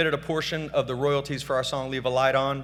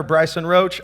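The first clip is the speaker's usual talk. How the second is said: ordinary talk, nothing marked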